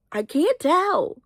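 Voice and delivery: high, slow sing-song whine; playfully whining the line again; mock whine. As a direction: stretch it out in a silly high voice. Repeated in silly high voice.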